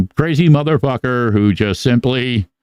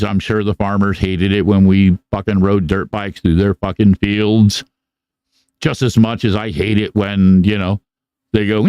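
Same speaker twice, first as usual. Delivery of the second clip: ordinary talk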